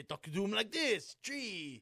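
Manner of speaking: mock French accent. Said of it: "I always say".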